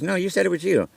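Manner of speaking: high-pitched landlord contradiction; mock landlord correcting someone; imitating insistent landlord. Landlord insists, retold argument.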